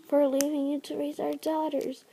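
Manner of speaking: soft, fading regret; dying queen speaking softly; faint regretful voice. Acted. Dying mate apologises.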